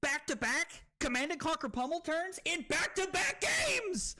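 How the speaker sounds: high, excited yell building at the end; hyped disbelief. Real, not acted. Streamer bragging about back-to-back turns, hyped yell. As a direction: crow about an unbelievable streak.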